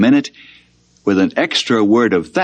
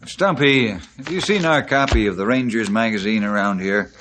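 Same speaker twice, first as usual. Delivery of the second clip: ordinary talk, nothing marked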